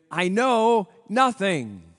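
slow, loud mock German guard accent, lightly done; Sergeant Schultz catchphrase. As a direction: stretch it out like Schultz, playful. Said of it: "would say".

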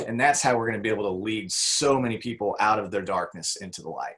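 usual speaking voice

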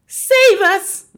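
high, very loud plea; terrified scream. A narrator's reading. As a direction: scream for rescue in panic. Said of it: "the mother screamed".

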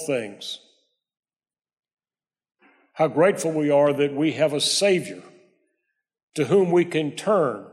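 measured prayer with long pauses. Real, not acted. Pastor leading the congregation before teaching, measured prayer. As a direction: pray unhurriedly, phrase by phrase. Let's pray.

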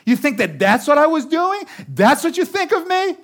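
high, loud wounded indignation; offended spouse. Acted. Other spouse taking offense mid-fight, loud and wounded. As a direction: sound deeply insulted. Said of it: "the other person goes".

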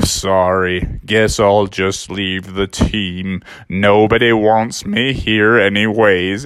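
low, slow, mopey donkey voice; gloomy Eeyore. Acted. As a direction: sigh it out like Eeyore. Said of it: "And Eeyore's like".

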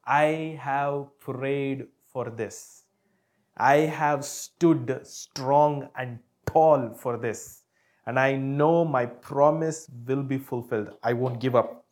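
slow firm declaration. Acted.

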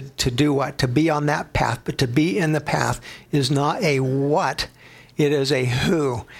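ordinary delivery

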